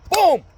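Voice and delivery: loud high boom; loud boom sound effect. Retold or imitated. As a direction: smack the sound out. Retelling another slap.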